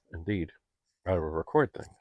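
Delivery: ordinary talk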